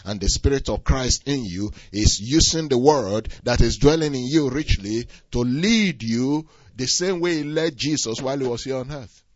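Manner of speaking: ordinary talk, nothing marked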